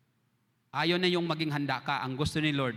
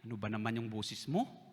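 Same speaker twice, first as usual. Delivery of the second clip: mock scolding; 7 semitones lower, 8 dB quieter than usual. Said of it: "hindi niya ako sinabi".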